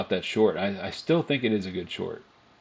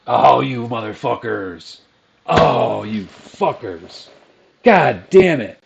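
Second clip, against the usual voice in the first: loud, drawn-out angry curses; trader's loud, real fury at a missed move; furious yelling; 4 semitones higher, 11 dB louder, slower than usual. Real, not acted. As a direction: bellow at the screen in real rage. Missed trade, cursing at market.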